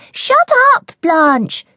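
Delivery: high, loud snap at her sister; angry little sister. Acted. Sister snaps at sister.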